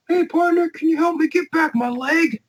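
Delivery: very high put-on old-timer voice; old veteran voice. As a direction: squeak it out like a goofy old cowboy. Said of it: "he's like".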